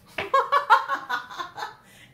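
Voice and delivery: shrieking burst of laughter; high loud laugh. Real, not acted. Reader bursting into laughter at the cards. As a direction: cackle out loud.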